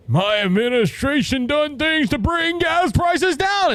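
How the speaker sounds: mock president. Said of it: "the president went on T V".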